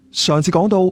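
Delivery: plain reading voice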